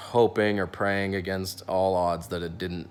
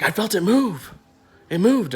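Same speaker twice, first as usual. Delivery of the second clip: much higher, breathless with surprise; excited patient; 12 semitones higher, 5 dB louder than usual. Retold or imitated. Patient telling the dentist the burr moved, excited. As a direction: announce it with sudden delight.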